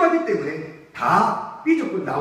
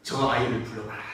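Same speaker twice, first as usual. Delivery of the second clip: voice of Jesus; 10 semitones lower, 6 dB quieter than usual. Acted. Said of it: "바디메오를 불러요".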